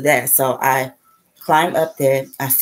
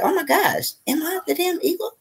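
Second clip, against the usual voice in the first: very high, squeaky astonished quote; high-pitched mock awe; acted amazement. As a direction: act out a thrilled realization way up high. Acts out own past amazed realization.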